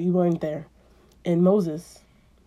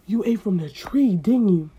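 louder accusation; loud stern accusation; accusing God; 5 dB louder than usual. Acted. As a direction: catch them out. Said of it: "he was like".

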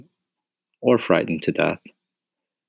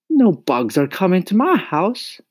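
high firm mother voice; mother refusing bugs in the house, high and firm; firm Mama; 12 semitones higher, 3 dB louder than usual. Acted. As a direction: lay down the law as Mama.

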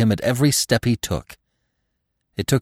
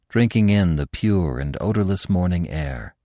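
usual speaking voice

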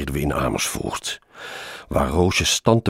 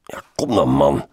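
raised, louder urging; impatient call; 6 dB louder than usual. A narrator's reading. Colleague waiting below the window, calling up impatiently. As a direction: call up impatiently, pitch lifted. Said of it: "riep Roosjes".

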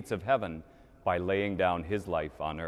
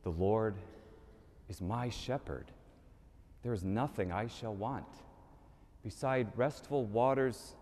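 soft, halting psalm with long pauses; hushed, earnest praying; quiet, determined prayer; 5 dB quieter than usual. Acted. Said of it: "very quietly at first, but very determined".

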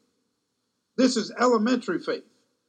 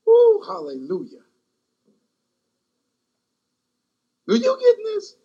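higher and louder, pushing the crowd; fervent preaching; 6 semitones higher, 3 dB louder than usual. Real, not acted. Preacher driving the point home to the church, fervent and rising. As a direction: climb to the peak of the sermon.

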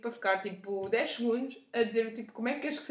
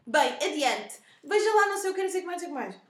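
impatient customer; 4 semitones higher, 6 dB louder than usual. Retold or imitated. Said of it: "E a senhora".

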